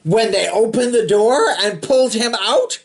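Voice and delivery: ordinary delivery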